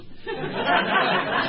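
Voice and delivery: a burst of high breathy laughter; real laugh. Real, not acted. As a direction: laugh out at the memory. Laughs at his own story.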